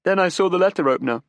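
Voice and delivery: put-on desperate young woman. Daughter defends her father to detectives, desperate.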